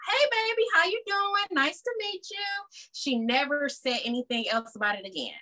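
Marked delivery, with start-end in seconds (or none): 0.1-2.9 high, sugary hostess voice imitating mom; mother sweetly greeting her new girlfriend, high and sugary. Mom's sugary high hello.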